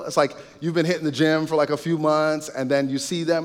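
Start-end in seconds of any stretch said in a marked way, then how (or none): none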